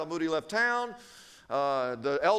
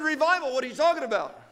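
high, startled questioning; incredulous husband; 4 semitones higher, 3 dB louder, faster than usual. Acted. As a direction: react as if blindsided by the news. Acted startled husband questions wife.

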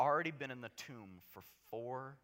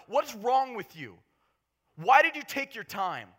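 higher, louder accusing cry; angry grief; 9 semitones higher, 12 dB louder than usual. Acted. Martha reproaching Jesus for coming late, angry and grieving. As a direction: confront him with raw frustration. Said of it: "She's upset. She's angry".

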